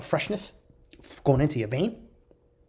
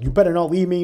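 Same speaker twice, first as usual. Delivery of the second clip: mock griping, pitched up and louder; retold bitching; 5 semitones higher, 6 dB louder than usual. Old lady bitching to her Lyft driver, mock-angry and louder. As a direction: imitate someone having a go at a driver. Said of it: "she's bitching to the Lyft driver".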